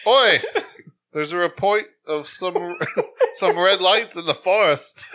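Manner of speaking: high, loud, laughing mock English accent; giggling through a bad English accent. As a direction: fumble a British officer's line through laughter. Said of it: "I'm not good at accents".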